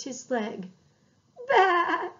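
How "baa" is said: high, loud lamb's cry; hurt lamb's high baa; crying bleat. A narrator's reading. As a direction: bleat in pain from the scrape. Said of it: "he cried".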